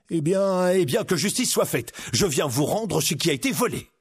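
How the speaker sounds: rousing declaration; heroic outlaw. Acted. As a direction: declare justice like a storybook hero. Hero throws off his disguise.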